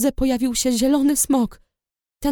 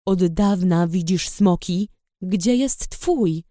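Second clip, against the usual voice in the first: a bit gentler; 4 semitones lower, slower than usual. A narrator's reading. Rider questioning the girl, a touch gentler and slow. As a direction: ask more gently, still firm. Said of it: "spytał trochę łagodniej".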